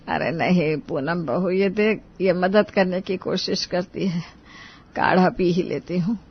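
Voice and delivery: unhurried, weary reassurance; ailing mother-in-law. Acted. (बीमार सास की धीमी आवाज़)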